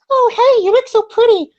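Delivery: put-on high falsetto girl cooing a compliment; girly voice. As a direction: gush it like a fake-sweet girlfriend. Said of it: "I'm trying to do your voice".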